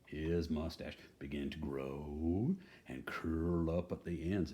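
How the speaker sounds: usual speaking voice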